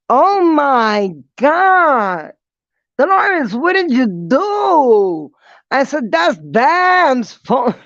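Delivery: high, loud, slow horrified disbelief; shocked realization. Retold or imitated. Speaker scolds sister Dolores over Bam's phone, high and horrified. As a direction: drag out the horror of the mistake.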